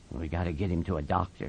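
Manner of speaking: very low, quiet voice; hushed, deep authority; grave Slim. Acted. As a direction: take charge quietly and quickly. Slim takes charge, low voice.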